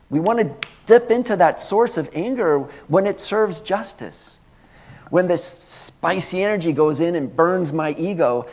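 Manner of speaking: forceful pulpit delivery building in power; fiery preaching. Acted. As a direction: preach it loud and high with full conviction.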